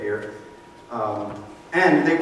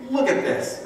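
much higher, a pointed mock accusation; mock accusing Pharisee; 11 semitones higher than usual. Pharisees accusing the disciples to Jesus, mock pointed accusation. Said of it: "come to Jesus with their accusation".

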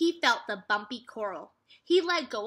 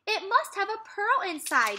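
excited realization; 10 semitones higher than usual. A narrator's reading.

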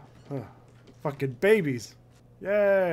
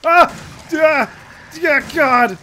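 high, very loud yelling; sharp high shrieked outburst; startled scream; 8 semitones higher, 9 dB louder, slower than usual. Real, not acted. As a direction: scream out in sudden fright. Startled under attack in game.

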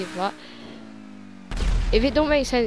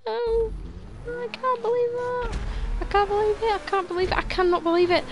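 much higher, wailing; real anguished disbelief; 12 semitones higher than usual. Real, not acted. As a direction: keep wailing the same line in despair. Just fell near the end.

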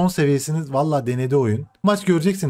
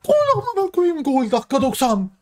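real, high-pitched elation; ecstatic goal cry; 10 semitones higher than usual. Real, not acted. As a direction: chant it high in triumph at a last-minute goal. Celebrates a last-minute goal.

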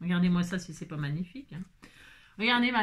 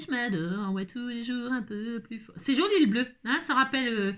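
ordinary delivery

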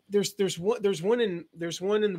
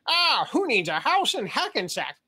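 slow, raised cartoon sailor voice; Popeye crossed with [name]; 5 semitones higher, slower than usual. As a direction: Popeye drawl mixed with a singer. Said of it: "my Popeye impersonation crossed with a [name] impersonation".

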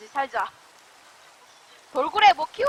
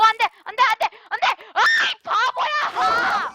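exasperated shouting; 7 semitones higher, 6 dB louder than usual. Real, not acted. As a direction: shout in frustrated alarm. Animal escapes, scolded as fool. (답답해서 크고 높게 소리치듯)

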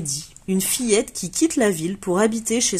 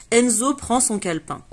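usual speaking voice

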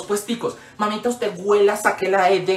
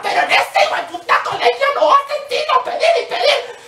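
laughing rant. Real, not acted. Ranting about school fees.

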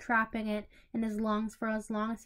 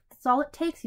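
louder, smug threat; smirking menace; 5 semitones higher, 7 dB louder than usual. Acted. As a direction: lean in with a smug edge.

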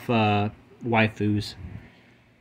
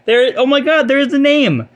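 much higher and louder, thrilled; high loud excited exclamation; excited discovery; 12 semitones higher, 7 dB louder than usual. Real, not acted. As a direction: burst out with real delight at the find. Finds the card's name.

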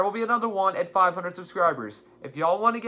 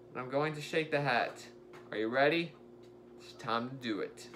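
suspenseful hush; 7 semitones lower, 10 dB quieter than usual. Real, not acted. Building suspense before the draw.

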